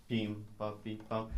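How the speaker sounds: "Bim, bop" as short detached syllables; staccato rhythm demonstration. Shown as a demonstration. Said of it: "very short".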